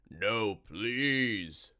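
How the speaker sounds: wailing monster begging; pleading monster. A narrator's reading. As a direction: stretch the plea out as a cornered monster. Monster begs for mercy.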